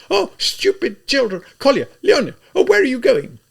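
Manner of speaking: high, loud, frightened calling; scared exclamation. A narrator's reading. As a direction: call after her fleeing children in panic. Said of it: "exclaimed in a scared voice".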